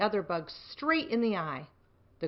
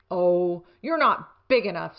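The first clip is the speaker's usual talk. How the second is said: dismissive; 8 dB louder than usual. A narrator's reading. Grouchy ladybug dismisses.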